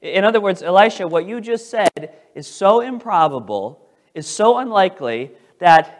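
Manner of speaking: loud, high mocking disbelief; the king's officer sneering at Elisha, loud and sarcastic; sarcastic scoffing. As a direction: scoff at the prophet like it's a joke. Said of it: "He's saying this critically and sarcastically".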